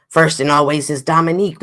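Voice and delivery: ordinary talk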